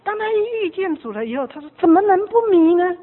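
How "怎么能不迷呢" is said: loud, high acted rhetorical question; acted burst of enthusiasm; exuberant exclamation. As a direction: exclaim it like a swept-away new convert. Repeats convert's exclaimed answer.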